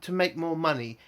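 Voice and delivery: usual speaking voice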